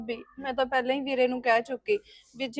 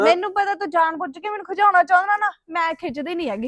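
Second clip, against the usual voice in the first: higher, louder annoyed snap; irritated outburst; 5 semitones higher, 9 dB louder than usual. Acted. Preet snapping at her boyfriend, irritated and loud. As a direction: accuse him of teasing her on purpose.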